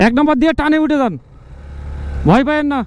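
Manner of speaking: loud, high calls to a rider; shouting encouragement. Real, not acted. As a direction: urge the rider on from below. Calling to rider on climb.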